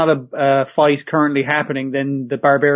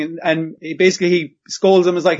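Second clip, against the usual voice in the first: ordinary talk, nothing marked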